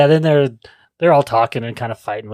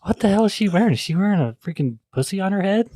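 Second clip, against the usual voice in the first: high, incredulous outburst at an outfit; pitched-up what-the-hell shock; shocked disbelief; 8 semitones higher than usual. Retold or imitated. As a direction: gasp it out like you can't believe your eyes. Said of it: "I was like".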